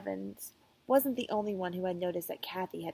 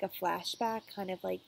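ordinary delivery, nothing marked

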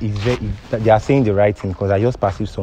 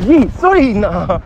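high, loud pleading; urgent high-pitched sorry; alarmed apology; 12 semitones higher, 7 dB louder, slower than usual. Real, not acted. Apologising as woman lashes out.

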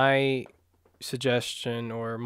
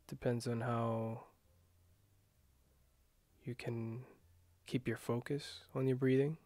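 guided meditation. Acted. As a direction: ease them into calm breathing, gently.

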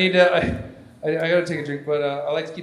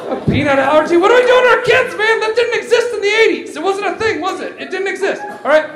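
high, loud disbelief; comic outraged protest, pitched way up; incredulous rant; 8 dB louder than usual. Acted. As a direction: yell as if it's absurd, then ease off a little. Mock outrage over allergies.

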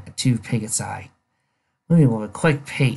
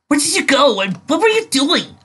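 much higher voice, pressing for answers; demanding; 5 dB louder than usual. A narrator's reading. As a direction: demand to know where she went. Rainbow demanding where Dust went.

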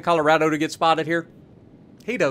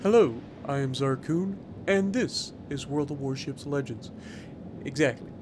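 soft, low mock channel intro; lightly voiced YouTuber greeting, dropping away; a quiet, understated take on a host's opener; Zarkun impression; 5 semitones lower, 7 dB quieter than usual. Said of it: "Ask, ask Zarkun".

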